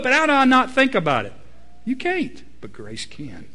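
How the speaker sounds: ordinary delivery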